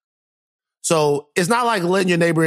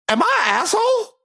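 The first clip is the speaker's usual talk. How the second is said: high incredulous question. Real, not acted. Pleading question to cohost.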